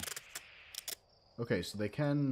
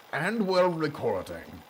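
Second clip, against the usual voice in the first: higher, louder grand nobleman; pompous noble voice; 4 semitones higher, 4 dB louder than usual. Acted. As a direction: announce it like a self-important aristocrat. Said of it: "I guess I'm gonna go into pompous noble voice".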